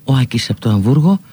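ordinary delivery

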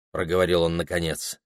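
plain reading voice

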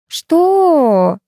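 high, drawn-out scoff; long, high dismissive question; snorting what. A narrator's reading. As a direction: stretch it out incredulously. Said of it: "фыркнула Арина".

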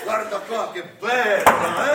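hammed-up grand unveiling; slow showy presentation. Santa showing the mother a balloon, slow and showy. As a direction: draw it out, proudly showing off.

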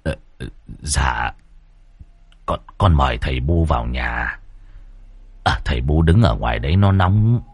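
a son trembling before his dead father's ghost. A narrator's reading. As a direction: run rẩy, lắp bắp. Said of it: "Lão run rẩy hỏi".

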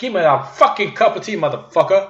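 a crude American demanding his tea; rude American. Retold or imitated. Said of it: "amerikanen hade sagt". (spelat burdus amerikansk brytning)

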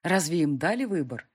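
usual speaking voice

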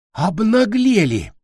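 high, stretched-out outraged shout; furious cry. A narrator's reading. Teacher faces boys frozen mid-fight, furious cry. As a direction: burst out in fury, pitched right up. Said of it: "крикнула, заикаясь от ярости".

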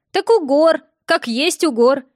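a village granny stating the obvious; old woman voice. A narrator's reading.

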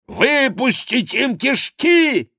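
slow, very high yell; bellowing threat. A narrator's reading. Maugiron threatens the Angevins, slow high yelling. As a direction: roar it out for blood. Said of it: "заорал Мажерон".

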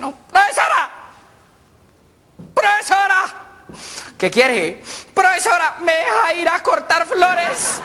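put-on a child shouting for the teacher in front of the principal; child calling out. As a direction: shout it across the room like a desperate pupil. Child calls out in assembly.

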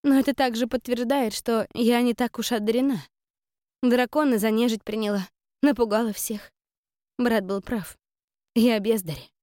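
upset, insistent self-reproach; self-doubting young woman. A narrator's reading.

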